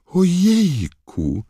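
very high drawn-out squeal; acted loud shriek. A narrator's reading. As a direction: squeal slowly in girlish alarm. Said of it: "krzyknęła znowu Lavender Brown".

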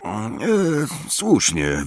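hesitant, halting mumble; stall, then mumble agreement; mumbled. A narrator's reading. Harry caught off guard by Luna, mumbling. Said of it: "wybąkał Harry".

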